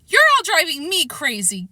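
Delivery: put-on frustrated kid yells at his mother; yelling back. A narrator's reading. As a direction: throw it right back at her. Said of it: "Kevin yelled back".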